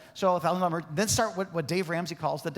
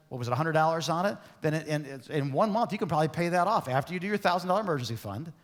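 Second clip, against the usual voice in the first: ordinary delivery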